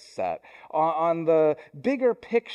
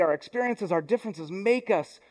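normal talking voice

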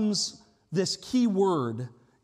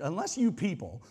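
contentious; 2 semitones lower than usual. Shown as a demonstration. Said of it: "it sounds really, like, contentious".

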